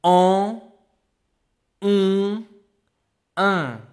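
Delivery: en, on, un said high and loud, one by one; teacher reviewing the nasal vowels; three nasal vowels. Shown as a demonstration. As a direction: set the three nasal vowels apart clearly. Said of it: "Repeat after me".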